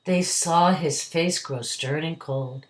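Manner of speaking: lively storybook poem reading; reading to a toddler. A narrator's reading. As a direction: read the ballad to a child. Reads the poem aloud.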